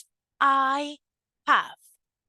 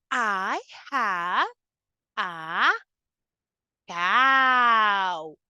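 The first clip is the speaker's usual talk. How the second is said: ordinary talk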